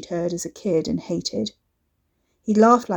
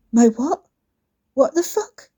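stunned outrage; 6 semitones higher, 5 dB louder than usual. A narrator's reading.

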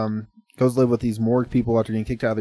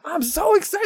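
very high mock-eager squeal; all hyped up. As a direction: play the overexcited recruit at the top of your pitch. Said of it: "he's getting all hyped".

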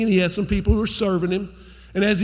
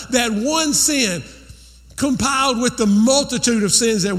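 rising, fervent preacher's drive, a little higher; preaching; 4 semitones higher than usual. Acted. Preacher urging the congregation to forgive, fervent. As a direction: build the sermon up and press it home. Preacher builds to full force.

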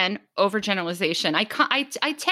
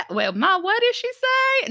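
high-pitched imitation of a confused relative; mock baffled relative; 14 semitones higher than usual. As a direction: call to Ma in exaggerated bafflement. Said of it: "they're gonna be like".

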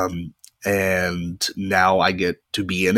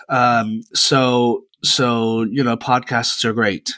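ordinary delivery

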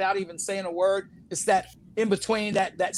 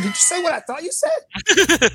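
high and louder, laughing through it; laughing disbelief; 10 semitones higher, 5 dB louder than usual. Retold or imitated. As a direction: ask it half-laughing, shocked. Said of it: "I said".

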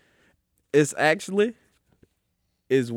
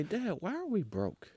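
lightly voiced kid, higher and softer; imitated kid; 7 semitones higher, 6 dB quieter, slower than usual. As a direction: put on a hint of a slow, whining child asking Dad. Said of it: "So when your kids say".

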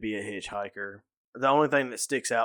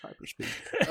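"Hyperspeed" squeaked high through a laugh, breathy and voiceless; 12 semitones higher, 6 dB quieter, slower than usual. Pretend. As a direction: giggle out "Hyperspeed" in a high whisper. Joking after a botched rewind.

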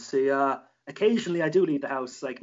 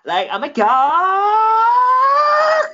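put-on high, drawn-out squealing Valley girl; 13 dB louder, much slower than usual. Shown as a demonstration. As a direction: stretch it way up like a California girl. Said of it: "I'll say it like a Cali girl".

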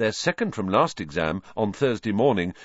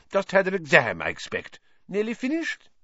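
higher, hearty minister voice; Fudge; 10 semitones higher than usual. A narrator's reading. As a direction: greet him with jovial bluster.

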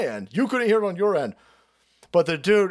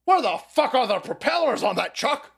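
higher, put-on baffled pilot; imitating a pilot; 6 semitones higher than usual. Host voicing a baffled American pilot, higher. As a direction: gasp it like a stunned fighter pilot. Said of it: "Mustangs going".

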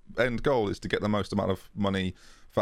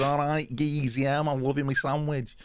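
higher-pitched, hammed-up Mancunian; Mancunian accent; 5 semitones higher, slower than usual. Imagining Kane's reply to a teammate at lunch, in a mock Mancunian accent. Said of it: "Kane replies in a Mancunian accent".

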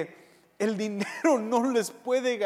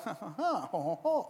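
soft laugh; 9 dB quieter than usual. Retold or imitated. Corinthian showing off.

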